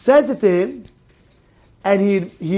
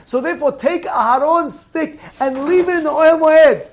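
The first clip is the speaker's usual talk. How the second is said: raised, high-pitched sermon delivery; forceful preaching; 7 semitones higher, 4 dB louder than usual. Acted. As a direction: drive each exhortation home to the congregation.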